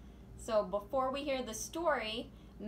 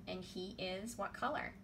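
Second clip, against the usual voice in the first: plain reading voice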